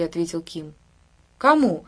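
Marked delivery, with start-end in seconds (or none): 1.4-1.8 high, louder startled question; joyful alarm. A narrator's reading. Woman hearing of a call-up notice, joyfully startled. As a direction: ask with happy fright, pitch jumping up. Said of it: "в радостном испуге округлила глаза".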